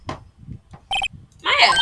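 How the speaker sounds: high, loud call of a name. Acted. Lawyer greeting a friend in the visitors' room, high loud call. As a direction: shout the name, pitch leaping up. Calling out to Maya on arrival.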